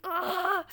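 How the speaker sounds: high, loud exasperated cry; fed up with both friends, a loud groan; frustrated groan. Acted. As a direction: let out the irritation.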